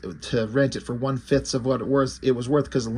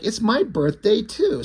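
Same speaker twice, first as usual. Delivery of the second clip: lightly voiced excited little girl, higher pitch; cute excited girl; 8 semitones higher than usual. Little girl behind them shares her birthday, cute excitement. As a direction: imitate a cute kid, slowly stretching the words. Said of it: "she's being kinda cute".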